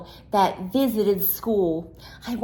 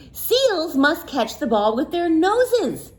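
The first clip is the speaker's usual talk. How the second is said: teacher; 8 semitones higher than usual. Acted. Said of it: "said the teacher".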